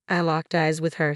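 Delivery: plain reading voice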